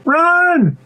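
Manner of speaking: high, urgent warning yell; panicked cry. Acted. Bee warns pea of a bird, panicked. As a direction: leap up in pitch to raise the alarm.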